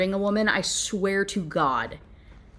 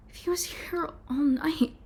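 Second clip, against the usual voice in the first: breathy unvoiced whisper, higher; 7 semitones higher, 5 dB quieter than usual. Reader whispering the narrator's dark suspicion. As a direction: hush it like a secret suspicion. Reading the book's tense inner thought.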